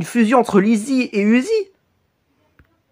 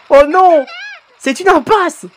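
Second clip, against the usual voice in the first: ordinary talk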